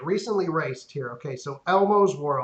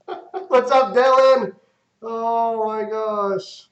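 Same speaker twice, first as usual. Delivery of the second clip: loud, high laughing greeting; 6 semitones higher, 7 dB louder, slower than usual. Real, not acted. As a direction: burst out laughing as you speak. Laughs at the mistake.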